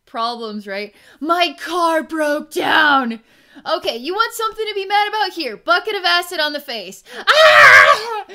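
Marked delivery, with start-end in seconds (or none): 1.2-3.2 a slow, loud whiny shout; hammed-up shout over a trivial gripe; petty complaint. Mimicking people angry about problems, a loud slow shout. 3.7-7.0 high, loud, fast shouted comeback; mock furious retort. Answering the car complaint with a high furious shout. 7.3-8.2 high, loud shriek of pain; acted scream as the acid hits. The acid victim, a high loud shriek.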